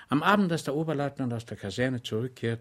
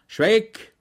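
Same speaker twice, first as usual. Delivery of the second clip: higher, louder stern officer's call; 11 semitones higher, 9 dB louder than usual. A narrator's reading. Lieutenant sternly addressing his orderly Schweig. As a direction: pull him up short like a superior. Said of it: "sagte der Oberleutnant streng".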